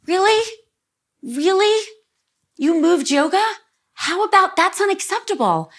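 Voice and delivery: incredulous outrage. Retold or imitated. Retelling her reaction to her friend, in high-pitched outraged disbelief. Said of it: "And I was like".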